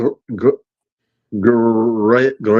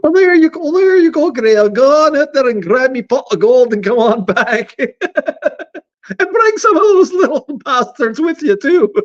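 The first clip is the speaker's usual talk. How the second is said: much higher comic leprechaun voice; 12 semitones higher than usual. Pretend. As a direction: boss the helper around like a leprechaun. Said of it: "sounds more like a leprechaun name".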